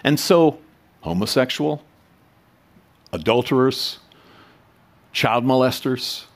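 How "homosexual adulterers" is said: usual speaking voice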